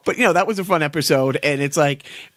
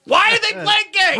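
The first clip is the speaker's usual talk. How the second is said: squeaky mock voice. Mocking a character in song.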